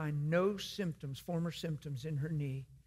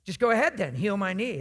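spiteful; 7 dB louder than usual. Retold or imitated. Sarcastic woman daring the preacher to heal her knee, loud and spiteful. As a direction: fling the challenge at him with scorn. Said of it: "she's being really spiteful".

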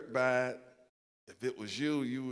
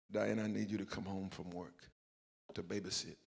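lower, softer, falsely calm phone voice; trying to be cool; 5 semitones lower, 7 dB quieter than usual. Retold or imitated. Husband calls wife at work hiding his injury, softer and lower, falsely calm. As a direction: keep it casual so she won't worry. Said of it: "So I'm trying to be cool".

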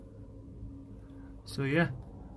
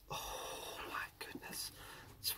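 awed whisper; 22 dB quieter, slower than usual. Real, not acted. Awed whisper at cave find.

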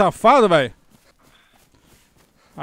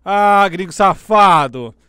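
drawn-out curse; 5 dB louder, much slower than usual. Real, not acted. Cursing the foreign player.

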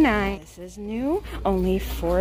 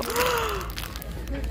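quiet delighted gasp; hushed surprised intake of breath; soft gasp; 10 semitones higher, 7 dB quieter than usual. Real, not acted. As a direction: breathe in sharply in wonder. Opens a journal.

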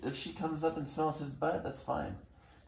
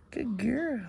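high praise; 12 semitones higher than usual. Real, not acted. Praising the dog.

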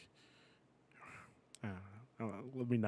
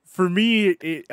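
ordinary delivery

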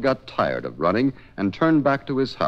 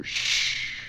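spray whoosh; 7 dB quieter than usual. Acted. Whale spray sound.

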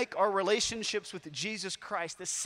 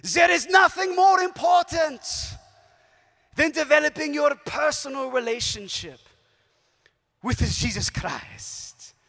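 put-on high, loud, shouted line in a German accent; booming German-accented papal quote, slowing at the end; Pope Benedict German accent; 6 semitones higher, 8 dB louder than usual. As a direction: proclaim it like a German pope to a huge crowd, in a German accent. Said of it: "I repeat in my best German accent".

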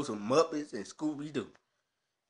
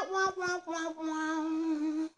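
high, nasal-free mock horn wails, sliding down; mock the entrance music with a silly high wah-wah; mock trumpet wah; much slower than usual. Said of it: "it's like".

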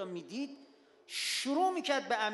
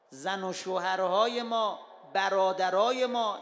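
loud, rising pulpit appeal; forceful preaching; 7 dB louder than usual. Acted. Preacher urges the congregation from the pulpit, loud and forceful. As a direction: hammer each phrase home to the crowd.

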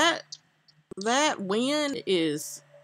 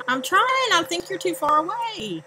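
higher, exasperated insistence; exasperated protest; 8 semitones higher, 3 dB louder than usual. Real, not acted. Player to teammate urging "get it", exasperated. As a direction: protest it higher, really frustrated now. Repeats it when pressed again.

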